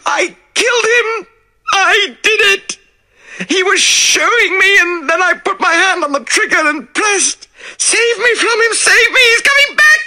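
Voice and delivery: high, loud, sobbing, building to a shriek; hysterical confession. Acted. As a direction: confess in wild terror.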